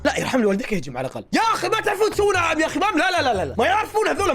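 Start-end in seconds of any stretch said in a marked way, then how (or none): 1.3-3.6 higher, fast, exasperated shout; frustrated rant. Real, not acted. Fed up with bad game. 3.6-4.3 high, laughing disbelief; incredulous chuckle on the words. Real, not acted. Laughing at the game makers.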